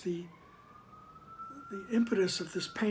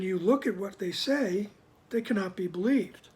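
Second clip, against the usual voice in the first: firm, louder reading of the text; formal reading; 5 dB louder than usual. Acted. Teacher reading scripture to the group, in a firm, louder reading voice. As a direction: read the passage out with weight.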